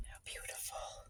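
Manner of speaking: breathy hushed whisper. Real, not acted. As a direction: whisper it in awe. Whispered admiration of squash.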